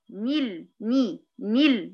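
نيل isolated and repeated with a clear i; kasra on nun. Shown as a demonstration. Teacher highlights the added vowel, isolated slow syllables. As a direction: sound out نيل, ني slowly as separate syllables. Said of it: "حركتها بالكسر".

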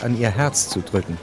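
ordinary talk

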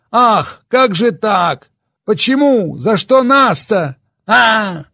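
put-on bitter drunk rant; drunk slurred speech. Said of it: "Речь его плыла".

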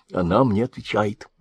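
ordinary delivery, nothing marked

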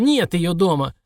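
put-on cranky old codger doubting; old prospector. Old servant voiced.

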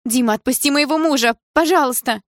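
high, loud, desperate plea; trembling exclamation. A narrator's reading. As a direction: beg frantically for his release. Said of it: "дрожащим голосом воскликнула мама". (отчаянная мольба дрожащим голосом)

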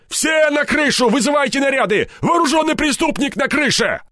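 high, strained shouted orders; deliberately loud. A narrator's reading. Степаныч orders squads so the gunman hears, shouting. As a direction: call it out so the enemy hears. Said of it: "он говорил это нарочито громко".

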